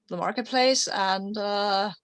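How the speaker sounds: ordinary delivery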